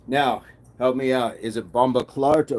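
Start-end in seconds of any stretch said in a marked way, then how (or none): none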